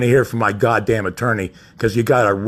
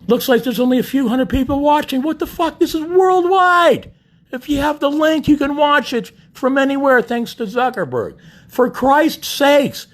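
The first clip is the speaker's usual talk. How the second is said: much higher and louder, frantic and exasperated; frantic pleading; 12 semitones higher, 3 dB louder than usual. Real, not acted. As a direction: beg the viewers to spread the link, voice climbing. Begging viewers to share stream.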